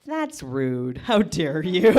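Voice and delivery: mock outrage. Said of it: "And I was like".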